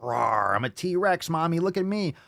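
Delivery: high pretend-dinosaur child; playful kid. Kid playing T-Rex for Mommy, high childlike voice. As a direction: play a kid showing off to Mommy. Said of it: "Kids will put their arms in their shirts and be like".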